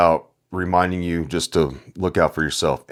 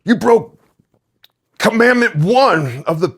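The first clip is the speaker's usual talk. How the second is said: much higher and louder, hammed-up scold; mock scolding; 6 dB louder than usual. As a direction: call them out like a stern referee. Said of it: "we can be like".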